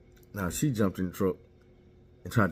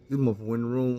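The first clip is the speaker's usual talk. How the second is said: usual speaking voice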